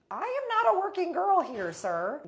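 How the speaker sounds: high, loud retold protest; acted-out outraged correction; indignant protest. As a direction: push the pitch right up in indignation. Repeats refusal to pushy man.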